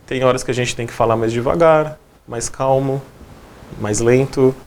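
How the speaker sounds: slow it down and soothe; calm and slow. Shown as a demonstration. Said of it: "eu falei de uma maneira calma, de uma maneira serena". (fala calma e serena)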